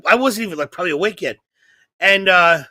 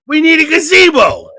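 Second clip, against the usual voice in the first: grandfather insisting; 9 semitones higher, 7 dB louder than usual. Retold or imitated. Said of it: "He goes".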